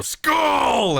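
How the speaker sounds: very high, loud shouted Skull; roaring toast shout. Acted. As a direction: roar it with the whole hall. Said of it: "And the others go".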